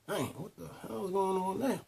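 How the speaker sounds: high, half-muttered disbelief, retold; shocked. Told to his boss about detectives, a retold shocked mutter. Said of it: "So I'm shocked".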